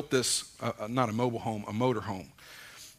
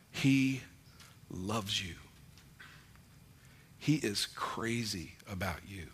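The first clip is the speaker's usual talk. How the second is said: softer, slow, heartfelt assurance; tender and sincere; 5 dB quieter than usual. Real, not acted. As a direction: lean in gently so they truly hear it. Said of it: "lock into me 'cause I want you to hear this".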